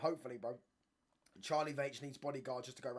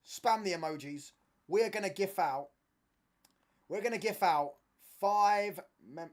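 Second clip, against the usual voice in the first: loud, high, excited announcer yell; the streamer hypes viewers before the giveaway, yelling excitedly; hyped-up shouting; 6 semitones higher, 10 dB louder, slower than usual. Real, not acted. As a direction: pump up the crowd, shout it out. Hyping the giveaway to chat.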